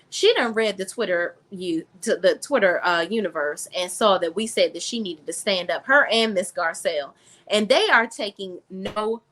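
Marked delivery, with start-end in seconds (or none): none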